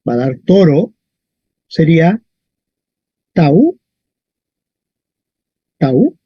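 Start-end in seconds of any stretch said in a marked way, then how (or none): 3.3-6.2 "tau" as one gliding syllable, A into U; diphthong in one syllable. Shown as a demonstration. Teacher isolates the diphthong for students, "tau" as one syllable. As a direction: glide from A to U in "tau". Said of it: "Es un diptongo".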